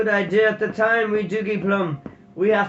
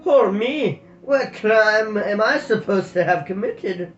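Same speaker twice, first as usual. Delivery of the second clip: higher, louder mock-innocent protest; roguish innocence; 6 semitones higher, 6 dB louder than usual. Tam feigning innocence to the guard, mock innocent. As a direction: play the innocent rogue. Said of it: "a picture of roguish innocence".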